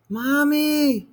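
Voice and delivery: put-on high child's cry for his mother; a scared little boy calling out; frightened cry. A narrator's reading. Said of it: "he cried".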